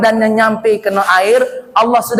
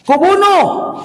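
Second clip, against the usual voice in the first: Pharaoh's threat; 10 semitones higher, slower than usual. Acted. Firaun kills the older child.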